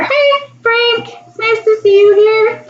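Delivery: very high-pitched, sing-out hello; delighted greeting. Real, not acted. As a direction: greet an arriving friend with delight. Greets viewer joining the live stream.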